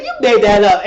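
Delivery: high, loud retold exclamation; loud disbelief. Friend hearing a made-up husband's name, loud disbelief. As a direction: call out the lie in disbelief.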